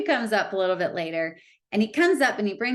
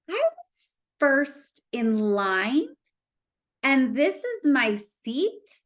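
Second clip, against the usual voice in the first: slow, higher-pitched, incredulous rising question; miffed disbelief; 5 semitones higher, much slower than usual. Retold or imitated. Wheelchair user sees her nosebleed seat after queuing first, miffed disbelief. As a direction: draw each phrase out, indignant and baffled. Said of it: "I was kind of pissed".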